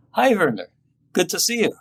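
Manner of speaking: forced cheer. A narrator's reading. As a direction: push out a bright hello you don't quite feel. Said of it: "I needed to force it a little".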